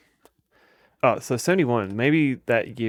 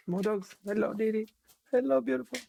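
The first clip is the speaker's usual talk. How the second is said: much higher squeaky pet voice; 12 semitones higher than usual. Real, not acted. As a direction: squeal sweetly at the dog. High baby talk to dog.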